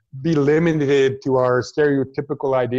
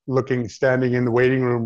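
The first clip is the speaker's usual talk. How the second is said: ordinary talk, nothing marked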